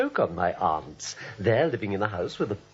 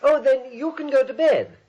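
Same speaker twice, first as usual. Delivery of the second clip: raised insistent voice; 10 semitones higher, 4 dB louder than usual. Acted.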